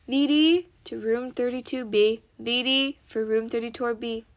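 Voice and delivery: loud PA call over the speaker; intercom announcement. Acted. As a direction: page it like a hospital intercom. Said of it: "over the intercom with a voice".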